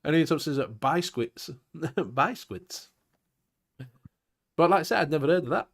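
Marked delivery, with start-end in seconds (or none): 0.7-1.5 "Biscuits" said as BYE-skwits; first syllable of "Biscuits" rhymes with "buy"; odd pronunciation. Shown as a demonstration. Host reading out a listener's message, "Biscuits" as BYE-skwits. Reads listener's joke spelling aloud. 2.1-2.9 "Biscuits" as BYE-skwits, after a laugh; amused repeat of "Biscuits" with a long "eye" vowel; odd pronunciation. Shown as a demonstration. Host relishing a listener's joke word, "Biscuits" as BYE-skwits.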